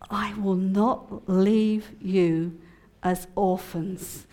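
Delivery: slow, grave reading of the verse; slow solemn verse; solemn scripture reading. Acted. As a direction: read it slowly as holy words.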